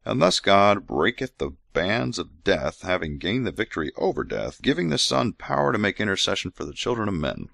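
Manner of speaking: measured scripture recital; scripture reading. Acted. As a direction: read it like holy writ. Reads scripture aloud.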